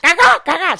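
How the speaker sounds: high, loud monkey call; monkey noise. Retold or imitated. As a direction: squawk like a monkey. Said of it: "She makes that noise".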